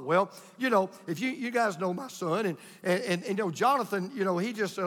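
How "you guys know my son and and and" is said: ordinary talk, nothing marked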